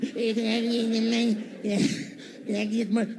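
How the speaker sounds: a lightly put-on beggar's voice; homeless guy voice. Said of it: "we're gonna talk about that voice".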